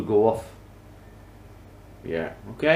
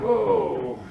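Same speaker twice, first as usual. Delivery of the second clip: surprised whoa; 6 semitones higher than usual. Real, not acted. Piece suddenly shifts.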